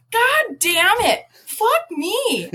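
high, slow, drawn-out swearing; high drawn-out swearing at the fence; exasperated cursing. Retold or imitated. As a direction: curse it out, fed up with the shocks. Shocked repeatedly, cursing.

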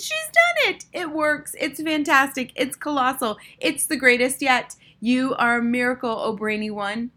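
high, jubilant cheering; yelled acclaim. A narrator's reading. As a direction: gush praise like an adoring crowd. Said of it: "yelled the audience".

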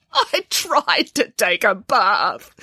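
slow, sobbing bear voice. Acted. Boris sobs to Nanny from inside the bathtub. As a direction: sob it out like a stuck child. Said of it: "sobbed Boris".

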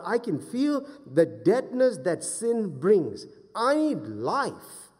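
high, loud, slowing for emphasis; impassioned preaching. Real, not acted. Preacher praying to God who raises the dead, impassioned preaching. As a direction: proclaim scripture, building into pleading prayer.

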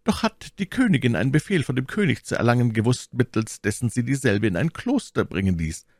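high, theatrical Milady voice; high, formal courtly request; scheming lady petitioner. A narrator's reading.

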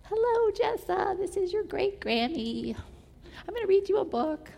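cute recorded greeting from great-grandma; grandmother's greeting. Retold or imitated. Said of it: "it would start with this cute little greeting".

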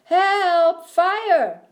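high, loud screams of panic; screams for help. A narrator's reading. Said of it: "heard screams".

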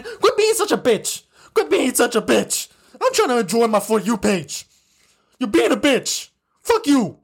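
high, exasperated rant; fed-up, heated outburst; angry telling-off. Real, not acted. Ranting at comment section.

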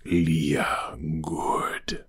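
soft breathy creature voice trailing into a whisper. Retold or imitated. Reviewer imitating the creature to Leah, soft creature whisper. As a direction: hush it like a monster blessing the girl. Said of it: "you heard the creature".

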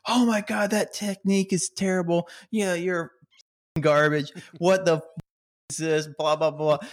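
high-pitched, hammed-up coach yelling; mock yelling coach. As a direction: ham up the furious coach screaming at a swimmer. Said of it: "just yelling at me".

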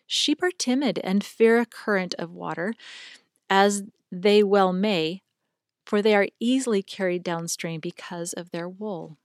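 usual speaking voice

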